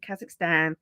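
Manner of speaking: Kazakhstan in a low-key nasal Brummie twang; nasal Brummie accent. Retold or imitated. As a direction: say it through the nose, Birmingham style. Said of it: "typical of the Birmingham accent".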